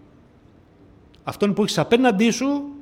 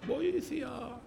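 a faint, far-off plea for help; the soul crying out, barely audible; mock cry for help; 6 semitones higher, 10 dB quieter than usual. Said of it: "θα το φώναζε". (αδύναμη κραυγή για βοήθεια)

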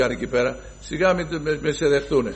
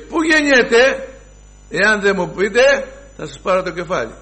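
menacing king; 8 semitones higher, 5 dB louder than usual. Acted. Herod threatening the priests, high and loud. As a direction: thunder it out like a tyrant king.

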